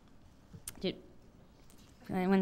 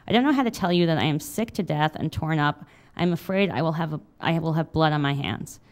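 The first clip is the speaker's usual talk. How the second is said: steady memoir reading voice; reading aloud; 2 semitones lower, 7 dB louder than usual. A narrator's reading. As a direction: read it like an author at a reading. Memoir read aloud to audience.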